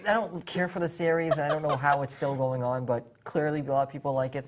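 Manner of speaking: usual speaking voice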